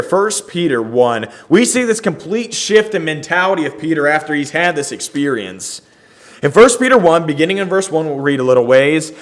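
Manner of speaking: normal talking voice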